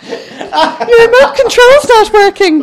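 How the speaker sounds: high, loud and slow, cracking up; laughing through the mum's punchline; mum's line, laughing. Retold or imitated. Mum complaining to daughter, laughing high and slow. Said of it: "going like this to the telly".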